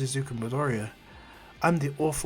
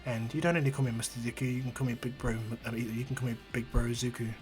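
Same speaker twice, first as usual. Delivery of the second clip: softer, gentle big-brother comfort; tender reassurance; 2 semitones lower, 6 dB quieter than usual. Acted. Izuku holding Eri, soft tender reassurance. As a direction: soothe her softly.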